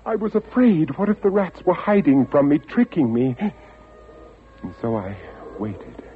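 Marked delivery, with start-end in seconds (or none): 0.1-3.4 higher, tense and fearful; anxious telling. A narrator's reading. Keeper recalling the ship he failed to warn, fearful. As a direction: let the old fear rise. Narrator recalling his fear.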